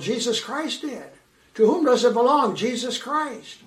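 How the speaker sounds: ordinary talk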